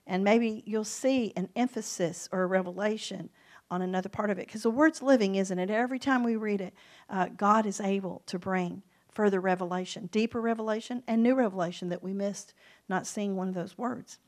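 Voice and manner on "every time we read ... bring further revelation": ordinary delivery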